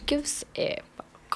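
whispered. Pretend. Whispered punchline of quote.

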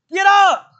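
God shouting at Joshua, loud and sudden; booming command. Retold or imitated. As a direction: bark it like God rousing a fallen man. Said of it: "With an exclamation point".

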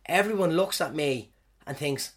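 ordinary talk

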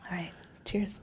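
soft whispered toast. Real, not acted. Toast before the bite.

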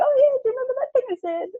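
very high, rapid mock voice; joking imitation. Friend teasing about an old remark, joking high voice. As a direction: rattle it off in a squeaky high pitch. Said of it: "we kind of joke about it".

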